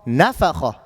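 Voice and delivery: NAfakha, opening NA emphasised; stress on first syllable. Shown as a demonstration. Teacher corrects the mistake, NAfakha stressed on the first letter. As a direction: stress the start of nafakha and let it fall. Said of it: "apply emphasis on the first letter".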